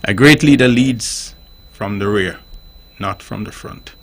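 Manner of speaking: put-on slow, measured South African accent; Nelson Mandela. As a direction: channel a dignified South African statesman. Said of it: "we speak in his accents, South African Af-, accent".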